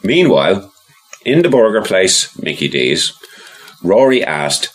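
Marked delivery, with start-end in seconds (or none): none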